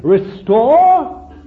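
high, loud plea; cry for restoring. Retold or imitated. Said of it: "nobody's saying".

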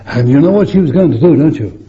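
fast, loud and high; excited rush. Real, not acted. Preacher eagerly teasing the congregation, fast and loud.

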